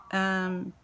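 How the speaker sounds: normal talking voice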